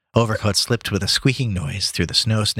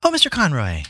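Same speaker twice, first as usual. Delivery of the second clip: high-pitched maid's greeting; Lily; 8 semitones higher than usual. Acted. Maid to guest as she opens the door, high greeting. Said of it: "said Lily to Gabriel".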